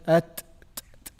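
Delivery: at, t, t with a breathy released t; whispered hams on ta. Shown as a demonstration. Teacher shows the student the breathy t sound. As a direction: let the air escape on the t of at. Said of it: "doing the hams on it".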